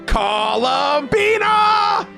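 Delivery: high, drawn-out shout of the name; long rising yell; exasperated yell. Acted. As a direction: holler the name in outrage, stretching every syllable. Acted angry call in the story.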